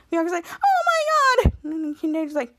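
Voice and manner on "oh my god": very high, drawn-out mock horror; acted horror. Bianca learns how far the elevator fell, horrified. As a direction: stretch it out high in play-acted shock.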